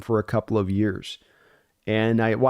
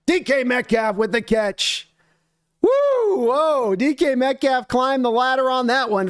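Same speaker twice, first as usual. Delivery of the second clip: excited; 10 semitones higher than usual. Real, not acted. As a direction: call the big catch, fired up. Big catch on live play.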